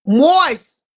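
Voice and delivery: ordinary talk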